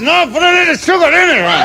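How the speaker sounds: very high, strained punchline cry; agonized patient. Acted. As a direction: squeal the request through the pain. Scalded patient's punchline reply.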